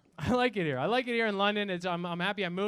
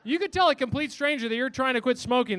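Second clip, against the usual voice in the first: ordinary talk, nothing marked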